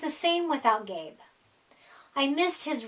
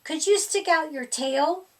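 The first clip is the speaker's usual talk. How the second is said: plain reading voice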